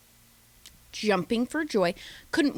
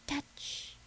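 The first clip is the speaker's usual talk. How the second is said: whispered touch; 15 dB quieter than usual. Retold or imitated. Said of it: "just goes".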